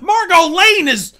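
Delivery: loud, drawn-out hype; slow booming hype call; excited shout. Real, not acted. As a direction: bellow it like a ringside announcer. Hyped cast reveal.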